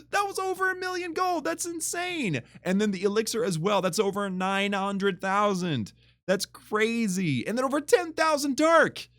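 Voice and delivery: shocked delight at loot; amazed. Real, not acted. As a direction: gush over the loot total.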